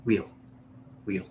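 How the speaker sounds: wheel said short, in one beat; one-syllable wheel. Shown as a demonstration. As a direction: keep wheel to a single syllable. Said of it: "one syllable word".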